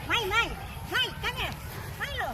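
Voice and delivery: high-pitched pet call; calling the dog. Real, not acted.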